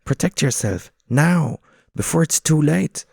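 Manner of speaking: high, loud, urgent pigeon. Acted. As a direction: push him before it's too late. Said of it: "says the other".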